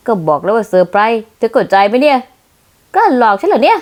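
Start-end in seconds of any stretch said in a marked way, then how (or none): 3.0-3.8 laughing tease. Acted. Playfully scolding while laughing.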